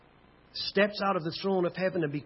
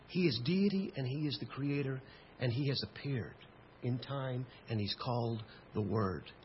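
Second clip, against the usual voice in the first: soft, lowered voice with weighty pauses; hushed solemn preaching; 5 semitones lower, 8 dB quieter than usual. Acted. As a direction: sum it up quietly and reverently.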